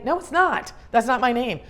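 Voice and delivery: high-pitched, insistent objection; indignant correction. Acted. Rejecting a wrong nickname, indignant and high. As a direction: push back hard, pitch jumping up.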